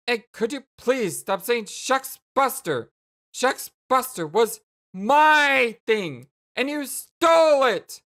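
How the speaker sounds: loud protest building to a high yell; indignant accusation. Acted. Jane accusing Jake of stealing her catchphrase, indignant. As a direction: stretch the key words in outrage.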